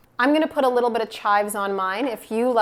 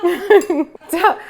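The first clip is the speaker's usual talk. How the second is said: laughing; 8 semitones higher, 8 dB louder than usual. Real, not acted. Jokey secret about husband.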